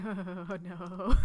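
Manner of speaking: long drawn-out playful moan; mock dismay. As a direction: stretch it out in pretend despair. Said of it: "where you're just like".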